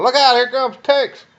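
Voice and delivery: high, loud warning yell; retold warning shout. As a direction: holler it up the muddy hill as a warning. Soldiers warning on hill.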